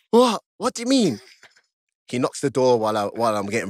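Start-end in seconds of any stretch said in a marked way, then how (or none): 0.1-1.2 mock disbelief. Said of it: "I'm like".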